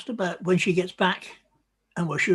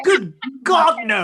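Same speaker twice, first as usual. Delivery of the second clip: aghast; 7 semitones higher, 8 dB louder than usual. Real, not acted. Horrified at idea of Act six.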